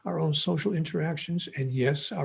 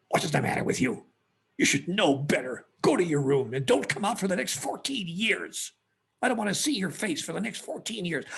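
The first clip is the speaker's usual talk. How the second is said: a lightly voiced grandfather scolding; stern scolding grandpa; 4 semitones higher than usual. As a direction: tell the child off like a stern old man.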